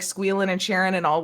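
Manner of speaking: ordinary talk, nothing marked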